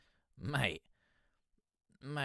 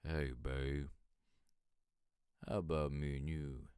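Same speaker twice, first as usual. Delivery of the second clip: smooth low love voice; 7 semitones lower, 8 dB quieter than usual. Retold or imitated. Teasing Kenneth about his voice, a low, soft seductive croon. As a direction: lower and softer, a crooner's seduction. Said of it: "You've got the voice".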